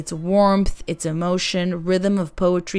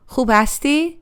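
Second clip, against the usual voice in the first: "Khub hasti?" climbing high on hasti; rising tone on hasti; 7 semitones higher than usual. Shown as a demonstration. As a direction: lift the pitch warmly through "hasti". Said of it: "The tone rises at hasti and it feels warm".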